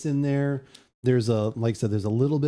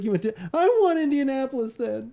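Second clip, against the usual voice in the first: put-on high kid's voice, defiant; a boy choosing a new team out of spite; pouting kid; 12 semitones higher than usual. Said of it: "And he's like".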